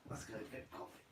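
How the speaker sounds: whispered aside to himself. Real, not acted. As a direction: breathe it out, no voice. Signing off to make coffee.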